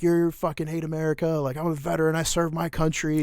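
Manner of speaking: high, indignant imitation of an angry veteran; retold outrage, pitched well up; furious. Veteran refused a discount, imitated furious protest. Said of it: "the dude got furious".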